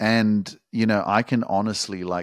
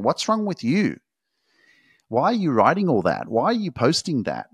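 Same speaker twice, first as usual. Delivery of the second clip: higher, incredulous nagging voice; mock critic; 6 semitones higher than usual. Coach mocking people who criticize his social media posts. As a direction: sneer like a judgmental commenter.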